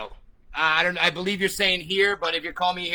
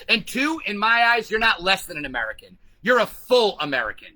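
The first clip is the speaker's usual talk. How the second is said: normal talking voice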